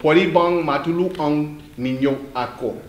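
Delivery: Pwede bang matulungan ninyo ako, slow and clear; slowly. Shown as a demonstration. Teacher repeats the Tagalog phrase for a student, slowly. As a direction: spell it out slowly. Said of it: "One more time, slow".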